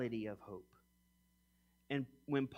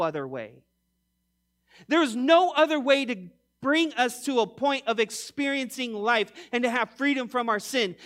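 louder, higher sermon voice driving each point home; full-force preaching; 7 semitones higher, 9 dB louder than usual. Acted. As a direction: preach it out to the congregation, urgent and insistent.

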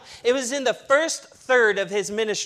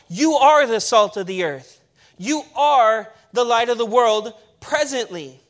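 ordinary talk, nothing marked

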